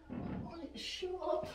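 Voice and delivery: drawn-out wince after banging his head; pained and slow. Real, not acted. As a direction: let the pain stretch the words out. Just hit head on ceiling.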